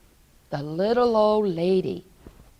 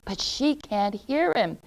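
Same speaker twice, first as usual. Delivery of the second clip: ordinary delivery, nothing marked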